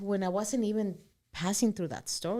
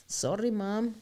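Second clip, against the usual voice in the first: ordinary delivery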